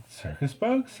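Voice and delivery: very high, shocked yell. A narrator's reading. Flick discovering his warriors are circus performers. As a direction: yell it in disbelief. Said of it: "he yelled".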